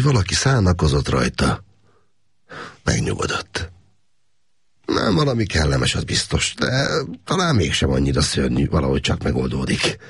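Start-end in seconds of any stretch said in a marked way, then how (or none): none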